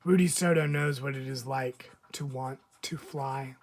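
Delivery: ordinary delivery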